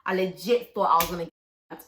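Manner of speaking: ordinary delivery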